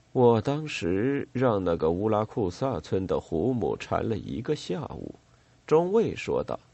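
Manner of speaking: plain reading voice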